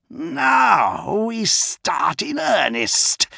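high, slow, eerie hiss; villain savouring the fight, hissing; hissed threat. A narrator's reading. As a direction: draw the threat out like a predator. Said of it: "he hissed".

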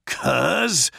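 a contained roar of outrage; roared name. A narrator's reading. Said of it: "Gilliman roared".

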